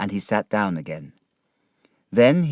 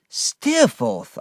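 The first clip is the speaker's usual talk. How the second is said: higher, stunned exclamation; shocked cry; 10 semitones higher, 4 dB louder than usual. A narrator's reading. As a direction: spit the name out in shock. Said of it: "I cried".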